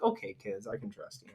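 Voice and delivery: soft, low, gentle reassurance; sweet Horton. Retold or imitated. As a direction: quietly reassure the kids. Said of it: "Horton, being as sweet as he is".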